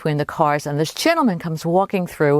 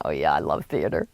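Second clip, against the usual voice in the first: low, softer play-along reply; knowing play-along; 12 semitones lower, 5 dB quieter than usual. Retold or imitated. Narrator answering the con man about theater, low knowing agreement. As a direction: agree casually, pitched well down.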